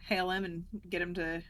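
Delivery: usual speaking voice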